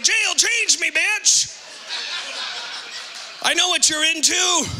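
high, cocky bragging; swaggering boast. Acted. Husband boasting to his wife after jail, high cocky swagger.

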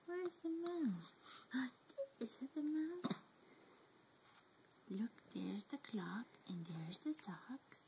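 soft whispered searching; hushed whisper. Acted. Parent whispering to baby over the picture book. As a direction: whisper like sharing a secret.

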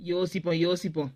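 low, hushed musing; soft, low aside to oneself; scheming aside. Acted. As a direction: think aloud under the breath. Plotting to herself.

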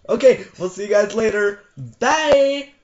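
high, loud sign-off; loud goodbye. Real, not acted. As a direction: shout bye to viewers. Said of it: "youtuber sign-off".

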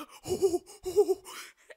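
loud, high hooting; high, loud burst of giddy release; excited whooping laugh. Real, not acted. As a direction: let out big nervous-excited hoots. Butterflies before game starts.

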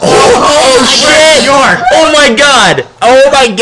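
high, loud surprised yelling; excited shout. Real, not acted. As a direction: yell out in sudden shock. Startled by webcam effect.

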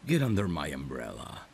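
ordinary delivery

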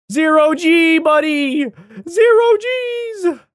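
very high, stretched, slow Zero Gs; drawn-out high call. Pretend. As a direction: float the words up like weightlessness. Announcing zero gravity.

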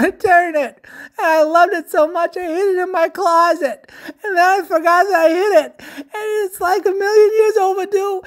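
high, loud tearful wailing; crying. A narrator's reading. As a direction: sob out a desperate confession. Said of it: "Splat cried".

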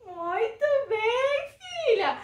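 high, stretched well done; proud, slow singsong praise; delighted praise. Real, not acted. Praises daughter after the song.

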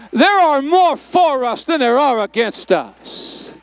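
very loud, slow, hammered declaration; full-force preaching shout. Real, not acted. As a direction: shout each word to the back row. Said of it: "Oh, you didn't hear me".

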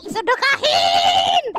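high, very slow bellowed command; a stretched-out holy command; slow drawn shout. Acted. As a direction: draw it out like a preacher's demand. Cow commands giving to charity.

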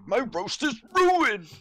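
high, loud wailing over the roast; mock dismay.

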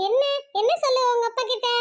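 normal talking voice

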